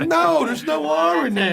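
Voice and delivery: loud, high, drawn-out protest; mock outraged shout. Correcting the Long Islander's added R, exasperated shout.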